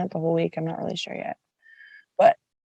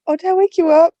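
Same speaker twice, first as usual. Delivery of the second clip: caller; 9 semitones higher, 6 dB louder, faster than usual. Retold or imitated. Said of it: "they'd be like".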